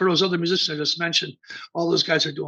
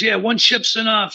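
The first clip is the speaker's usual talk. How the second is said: high, loud, joking jab; teasing; 6 semitones higher, 6 dB louder than usual. Retold or imitated. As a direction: tease him with the pun. He goes.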